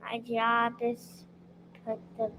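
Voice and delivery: soft, halting answer; too quiet. Real, not acted. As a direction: start quietly and pause midway. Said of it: "speak loud".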